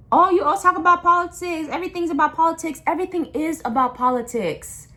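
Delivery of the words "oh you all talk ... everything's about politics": mocking whiny voice. Parroting people who whine that everything's political, in a high sneering mock voice. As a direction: mimic someone rolling their eyes at politics.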